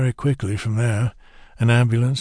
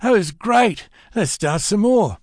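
thrilled, wanting more of the fight; gleeful; 9 semitones higher than usual. A narrator's reading. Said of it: "standing over them laughing".

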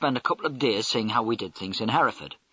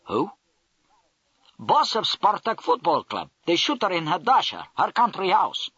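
a lightly indignant Russian voice; indignant Russian accent; 6 semitones higher than usual. Acted. The Russian major, twisting round in his seat, grumbles indignantly in a Russian accent. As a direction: complain about the mafia in a touch-indignant Russian accent. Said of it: "he instantly became indignant".

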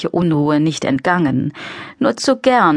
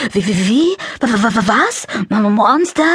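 high scared stutter, like lambs; frightened stammer; 6 semitones higher than usual. A narrator's reading. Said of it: "wie zwei Lämmchen klangen". (ängstliches Stottern wie Lämmchen)

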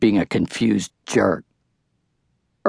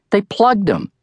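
excited; 10 semitones higher than usual. A narrator's reading. Excited kid watching movie.

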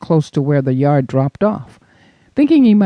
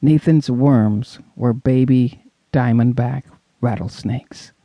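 ordinary delivery, nothing marked

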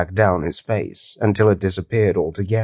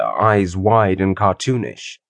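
ordinary delivery, nothing marked

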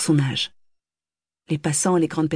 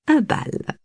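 smiling, higher, proud; 11 semitones higher than usual. A narrator's reading. Mother announcing the ball, smiling proudly. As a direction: savour it with pride. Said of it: "dit madame Kampf en souriant".

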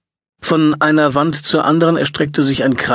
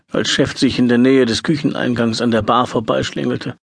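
ordinary delivery

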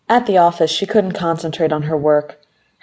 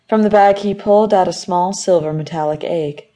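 ordinary delivery, nothing marked

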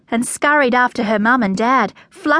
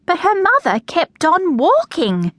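plain reading voice

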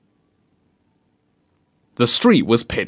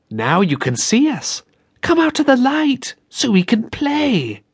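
put-on eerie girl's voice; 11 semitones higher than usual. A narrator's reading. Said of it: "The girl spoke again".

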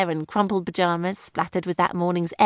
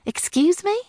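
sharp, much higher indignant question; bristling; 11 semitones higher than usual. A narrator's reading. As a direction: bristle at the jibe. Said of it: "Daisy had instantly bristled".

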